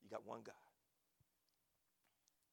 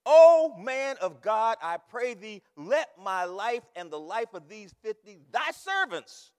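drawn-out, very loud plea; loud beseeching; 4 semitones higher, 19 dB louder, slower than usual. Retold or imitated. Third captain on his knees before Elijah, loud beseeching. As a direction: cry out slowly for your life. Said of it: "and besought him and said".

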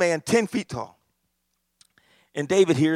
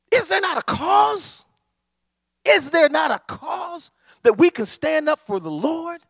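slow, high, loud shouted challenge; David shouting to stir the troops; rallying shout; 9 semitones higher, 5 dB louder than usual. Acted. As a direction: cry it out to the whole army. David's rallying cry retold.

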